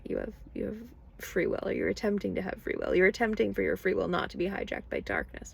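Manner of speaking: ordinary talk, nothing marked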